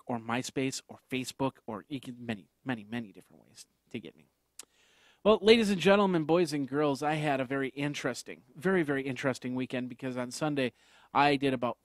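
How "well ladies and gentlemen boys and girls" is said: ringmaster. Acted. Segment opening, circus-style call.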